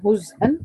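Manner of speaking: هزءا said "huz'an", no vowel after the z; silent zay. Shown as a demonstration. Teacher asking who reads it so, هزءا with a silent zay. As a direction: close the z straight onto the hamza in هزءا. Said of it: "مين كمان بيسكن الزا؟".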